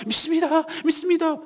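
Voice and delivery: loud, high mock profession of faith; anxious professing. Pastor mimicking believers who only want to believe, anxiously loud. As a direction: cry it out insistently, imitating an uneasy believer. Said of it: "그러니까 불안하니까".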